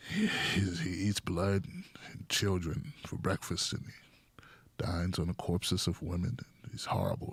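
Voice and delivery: a hushed doom-laden parody of a liberal pundit; mock Michael Moore rant. Comedian mocking Michael Moore, low hushed voice.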